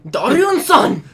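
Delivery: loud high Japanese anime shout; Japanese anime accent. Retold or imitated. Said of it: "say it in the Japanese accent".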